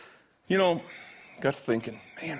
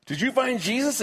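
a preacher losing patience, raised voice; preacher's demand; 11 semitones higher than usual. Acted. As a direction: push the question at him harder. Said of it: "Pulls the drunk guy up".

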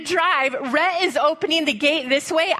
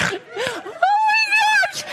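very high, slow drawn-out cry; panicked exclamation; much slower than usual. Retold or imitated. Mom cries out as the bull comes breathing, stretched. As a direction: stretch it out in terror.

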